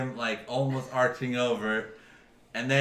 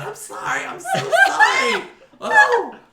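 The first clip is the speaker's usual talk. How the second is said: very high, loud squeaky goblin apology; flustered goblin; 11 dB louder than usual. Acted. Goblin apologises while clutching his pants, squeaky high. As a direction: gush out sorry in a tiny high voice. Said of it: "he starts grabbing his pants".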